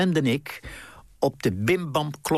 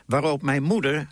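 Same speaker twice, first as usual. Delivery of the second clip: ordinary delivery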